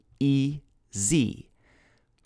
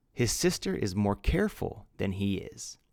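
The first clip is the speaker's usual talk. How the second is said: ordinary talk, nothing marked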